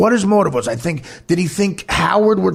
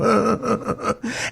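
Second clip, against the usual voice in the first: mock smug giggle, quiet and higher; imitated giggle; 4 semitones higher, 4 dB quieter than usual. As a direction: imitate the friend's sneering little giggle. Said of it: "he would giggle like that".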